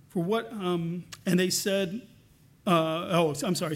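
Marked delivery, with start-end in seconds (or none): none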